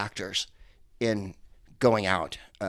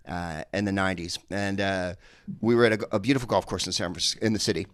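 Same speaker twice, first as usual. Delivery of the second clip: normal talking voice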